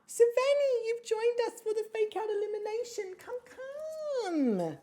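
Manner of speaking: very high squeal to a cat; gushing pet greeting. Real, not acted. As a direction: coo high-pitched at your cat to come over. Greeting and coaxing the cat Savannah.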